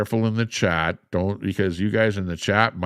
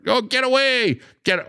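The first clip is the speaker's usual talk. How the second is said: mock panic. Panics as police arrive.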